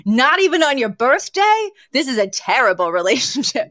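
a hint of Yetta, incredulous and laughing; lightly voiced Yetta. Retelling a sitcom line, playing grandmother Yetta teasing Fran, amused. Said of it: "And then Yetta goes".

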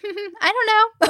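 high, slow, sweet and shy; polite cute voice. Retold or imitated. Imitating Diana shyly brushing off paparazzi, high and sweet. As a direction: coo it like a shy young woman. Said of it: "her polite, cute little self".

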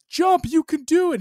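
high, loud cheering yell; shouting encouragement. Retold or imitated. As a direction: cheer him on at full volume. Crowd cheering him on, retold.

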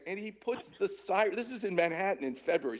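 ordinary talk, nothing marked

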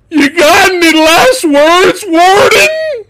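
very high, slow, strained shriek; overacted villain yell. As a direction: scream it melodramatically, stretching the words. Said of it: "that's exactly how he said it".

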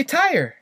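"tire" in two clear syllables, tie-er; standard American "tire". Shown as a demonstration. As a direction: General American "tire" with a full glide. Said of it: "in American English".

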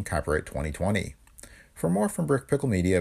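usual speaking voice

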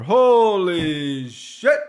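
slow, stretched-out holy shit; drawn-out awe. Real, not acted. Podcaster reacting to each fight on the card, slow and awed. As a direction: let it out in slow amazement. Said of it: "I can't keep doing that".

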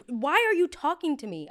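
high, loud comeback; retold indignant retort. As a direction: throw it back in mock indignation. Retold heated quarrel with a stranger.

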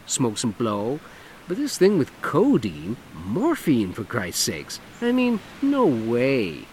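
camp it up, lilting and showy; flamboyant man. Acted. Dougie voiced in story.

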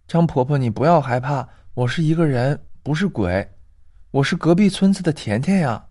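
put-on a little girl insisting she's no ghost; young girl's voice. Girl reassures grandma.

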